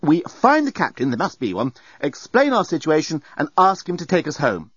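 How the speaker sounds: firm. Acted. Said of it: "said Nettie firmly".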